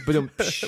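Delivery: vocal drum sting after the pun; rimshot. Pretend. Mouth drum sting to punctuate a pun. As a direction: mouth a rimshot for the bad joke. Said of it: "Great segue".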